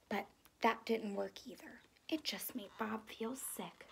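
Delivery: hushed soft narration; whispered. A narrator's reading. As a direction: drop to a whisper.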